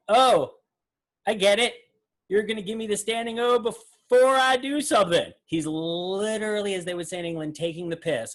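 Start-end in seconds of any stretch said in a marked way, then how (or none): 0.1-5.3 higher, louder mock of a magician chuckling at the crowd; a playful imitation of the magician laughing at an over-eager audience; teasing Mac King. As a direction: gently rib the audience in a lightly put-on showman voice. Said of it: "and being like".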